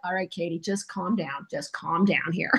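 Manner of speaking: low, very soft, deadpan soothing; hushed calming. Retold or imitated. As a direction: quietly talk an overexcited friend down. Soothing an overexcited friend, retold.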